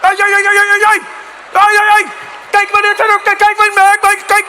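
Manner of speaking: high, loud frantic yelling in mock Dutch; rapid-fire "ay, ay, ay" from a furious cyclist; hammed-up Dutch outrage; losing it. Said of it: "people lose their shit".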